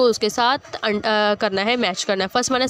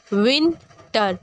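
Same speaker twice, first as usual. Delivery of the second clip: "Winter" slowly, pause before टर; split into syllables; much slower than usual. Shown as a demonstration. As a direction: break "winter" into विन and टर. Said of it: "word के दो हिस्से हो रहे". ("Winter" धीरे, दो हिस्सों में)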